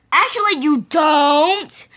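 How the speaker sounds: high, loud sassy retort; mocking comeback. Girl snaps back in argument.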